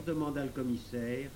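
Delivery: usual speaking voice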